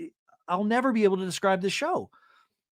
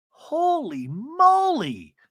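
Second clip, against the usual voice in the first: stunned; 10 semitones higher, 4 dB louder, much slower than usual. Real, not acted. Stunned reaction.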